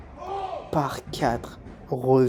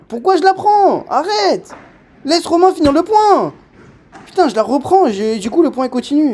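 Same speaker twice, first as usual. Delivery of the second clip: shouting at himself, much higher and louder; furious self-scolding; 11 semitones higher, 7 dB louder than usual. Real, not acted. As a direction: yell at yourself for stealing the ball. Se reproche d'avoir pris la balle. (engueulade furieuse contre soi-même)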